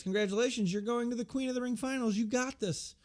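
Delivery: high-pitched cheerleading quote; mock congratulations. Wrestler congratulating a friend on reaching the finals, high and encouraging. Becky's congratulation retold in acted voice.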